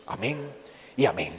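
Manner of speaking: usual speaking voice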